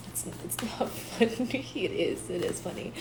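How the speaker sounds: fighting back a giggle under her breath; stifled laughter. Real, not acted. Admitting to viewers it is funny, near-whispered giggle.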